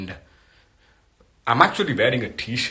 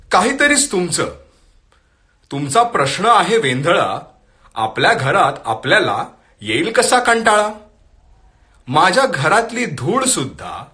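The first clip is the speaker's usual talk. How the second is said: louder, lilting Marathi verse reading with lifted pitch; poetry recitation; 4 semitones higher, 5 dB louder, much faster than usual. Acted. Host reads a forwarded Marathi poem to viewers, poetry recitation. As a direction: recite the poem line by line to an audience.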